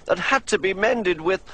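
James the engine. A narrator's reading. James miserable, voiced higher.

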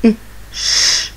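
i then a long unvoiced sh; whispered sh after i; phonics sounds. Shown as a demonstration.

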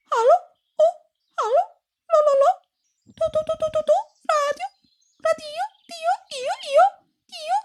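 very high chirpy bird voice, trilling the syllables; nightingale voice. A narrator's reading. Nightingale chirping down a crackly line, high and trilling. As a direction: chirp it like a nightingale calling into a radio. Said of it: "Pierwszy słowik zaczął tak".

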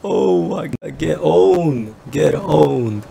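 high, stretched-out crowing, coming down; gloating taunt. Real, not acted. Gamer gloating over a beaten rival, crowing taunt. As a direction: draw out the taunt on owned. Gloating after a big hit.

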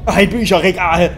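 sudden exclamation. Pretend. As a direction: blurt it out at full volume. Sudden outburst at in-game sight.

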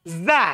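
"Za" with a voiced buzzing z, not s. Shown as a demonstration. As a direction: buzz the z in "Za" clearly. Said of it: "Bukan sa". ("Za" dengan z berdengung, bukan s)

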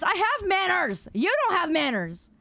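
mock indignation. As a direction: argue back in exaggerated offense. Indignant mock quarrel with chat.

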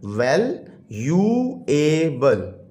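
वैल्यूएबल drawn out slowly, syllable by syllable. Shown as a demonstration. Teacher counts syllables for the class, very slowly. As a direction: break वैल्यूएबल into its four beats. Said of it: "four syllable है".

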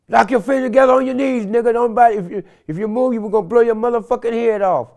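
imitating a SWAT officer shouting commands; retold SWAT shout. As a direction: yell it like a cop with a gun drawn. SWAT team yelling orders at him.

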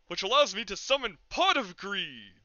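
dramatic card shout. Pretend. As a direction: announce the card like a duelist. Meme card game shout.

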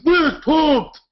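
high, loud mock hype; excited shout. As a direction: shout it like a hyped fan. Mock answer to Olympics question.

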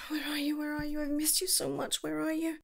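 missing someone, almost to yourself; wistful longing. Acted. Alone, longing for absent lover.

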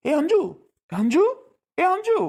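high, rising calls of a name; calling out. Acted. Man calls for his missing companion, calling out. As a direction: call out searchingly for someone missing.

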